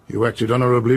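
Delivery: ordinary talk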